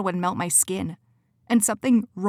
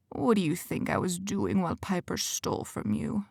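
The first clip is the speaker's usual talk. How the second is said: quiet menace; 6 dB quieter than usual. Acted. Quentin mocks Cora's ignorance, soft and menacing. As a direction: lean in and threaten gently. Villain voice in the story.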